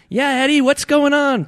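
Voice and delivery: high, loud shrimp pipe; piping in. A narrator's reading. Another shrimp joins the greetings to Ed, piping up. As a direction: pipe up like a tiny critter. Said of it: "piped in another".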